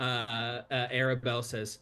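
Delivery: ordinary talk, nothing marked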